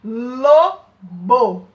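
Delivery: Lobo said loud and exaggerated, the L clearly tongued; sounding out lobo. Shown as a demonstration. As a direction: punch out Lobo to show the start L. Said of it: "A nossa língua encosta lá no nosso dente".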